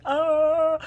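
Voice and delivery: loud, high falsetto scream; hammed-up shriek while fleeing; imitated squeal of panic; mock scream.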